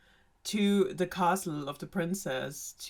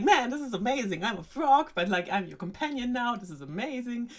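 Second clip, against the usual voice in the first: higher-pitched, delighted mock frog; happy chatty frog; 6 semitones higher than usual. Frog chatting to the princess at the table, happy and excited. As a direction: gush like an overjoyed little frog, hammed-up. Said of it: "He's very happy and chatty".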